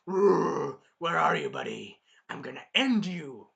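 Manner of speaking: loud roaring beast voice; monster dog. Acted. Monster dog hunting the hero, roaring threat. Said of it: "the monster dog is like".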